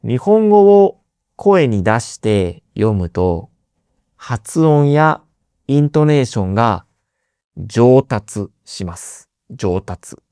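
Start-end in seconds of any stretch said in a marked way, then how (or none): none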